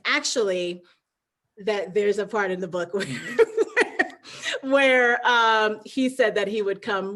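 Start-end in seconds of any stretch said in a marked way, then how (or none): 3.1-4.6 real unvoiced high laugh; breathy laugh. Real, not acted. Guest remembering husband's run promise, real laugh. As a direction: burst out laughing at the memory.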